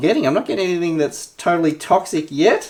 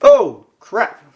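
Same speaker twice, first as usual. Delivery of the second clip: sudden high startled cry; jolted by a burst can; startled exclamation; 8 semitones higher, 6 dB louder than usual. Real, not acted. Opening leaking ravioli can.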